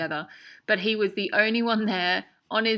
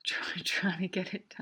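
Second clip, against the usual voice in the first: whisper; 5 dB quieter than usual. Real, not acted. Laughing aside about Sam's poster.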